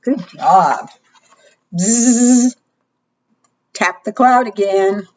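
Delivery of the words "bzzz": bee buzz. Shown as a demonstration. Imitating a bee.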